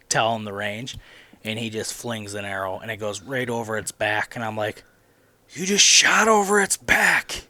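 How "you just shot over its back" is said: high, loud, drawn-out disbelief; incredulous outcry. Retold or imitated. Son to dad after the missed shot, loud incredulous cry. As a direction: cry it out slowly, stunned by the miss.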